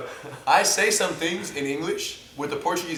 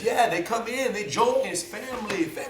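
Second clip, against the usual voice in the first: higher-pitched mock Portuguese accent; 5 semitones higher than usual. Instructor describing the school to visitors, mock Portuguese accent. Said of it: "with their little Portuguese accent".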